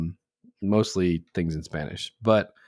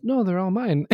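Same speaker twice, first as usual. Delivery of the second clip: high-pitched mimicry of a possessive child; little girl; 10 semitones higher than usual. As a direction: play a small girl claiming everything. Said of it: "She goes".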